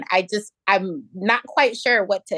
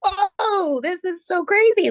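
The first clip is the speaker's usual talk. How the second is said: much higher, amazed exclamation; stunned whoa; 11 semitones higher, slower than usual. Retold or imitated. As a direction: blurt it out, floored by what she heard. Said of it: "was like".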